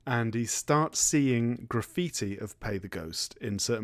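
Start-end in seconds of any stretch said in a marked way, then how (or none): 2.6-3.4 much softer, lower eerie murmur; spooky hush. Retold or imitated. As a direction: let it hang like a creepy omen. Spooky graffiti phrase.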